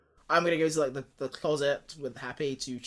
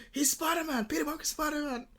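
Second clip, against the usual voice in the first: very high, strained mock outcry; pitched-up tattletale wail; Mysterio; 13 semitones higher than usual. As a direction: squeal out an accusation, hammed up. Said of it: "Mysterio's going".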